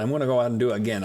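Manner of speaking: usual speaking voice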